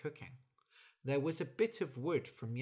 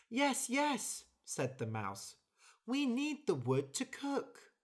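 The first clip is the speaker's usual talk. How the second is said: concerned mouse; 10 semitones higher than usual. Acted. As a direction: ask gently, pitched up like a tiny mouse. Mouse voice, high.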